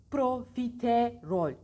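slow, loud profiterol with wide-open mouth; exaggerated profiterol. Shown as a demonstration. Teacher demonstrating exaggerated mouth opening for practice. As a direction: overarticulate every syllable. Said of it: "abartarak açın ağzınızı".

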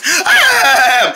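high, drawn-out angry yell; exasperated yell. Acted. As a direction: scream over the laughter. Pants erupts at being laughed at.

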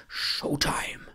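unvoiced whisper, psyching up; whispered showtime cue. Real, not acted. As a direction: breathe the word like a secret cue. Psyching up before client arrives.

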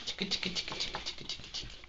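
soft voiceless tique tique, fading; whispered tique tique. Real, not acted. Friend whispering tique tique to tease the ferret.

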